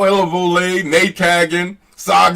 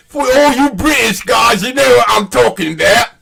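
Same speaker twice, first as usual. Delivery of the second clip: loud call-out; 8 semitones higher, 4 dB louder than usual. Real, not acted. Calling out to British fans.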